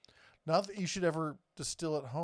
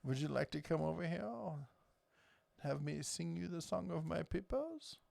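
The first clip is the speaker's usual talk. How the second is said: softer, put-on French accent; 7 dB quieter than usual. Shown as a demonstration. Showing off to an unimpressed co-host, mock French accent. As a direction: play the French charmer, accent laid on. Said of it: "I can do my French accent".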